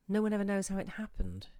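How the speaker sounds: plain reading voice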